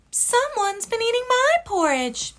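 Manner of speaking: sing-song like a fussy mama bear. Acted. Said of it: "Mama Bear looked at hers and said".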